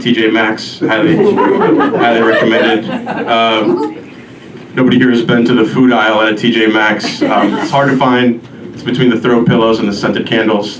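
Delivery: normal talking voice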